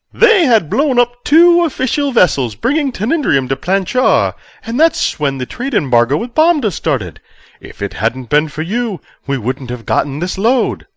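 high, loud, overjoyed official; giddy chancellor. A narrator's reading. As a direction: burst out with delight like a giddy schoolboy. Verbidi explains rebels.

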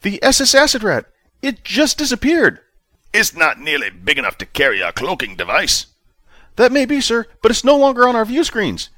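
officer reporting to his commander, high and tense; nervous junior officer. A narrator's reading. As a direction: report urgently like a rattled subordinate.

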